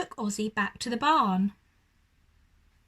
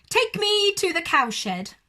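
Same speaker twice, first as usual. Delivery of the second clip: high, loud command; bossy urgent order; loud demand; 8 semitones higher, 7 dB louder than usual. Acted. Owl ordering horse.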